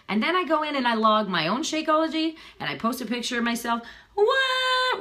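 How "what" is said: sudden high squeak of a word; surprised what. Real, not acted. Talking to her viewers, a high squeaked what. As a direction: pop the word up in mock surprise. Playful exclaim mid-story.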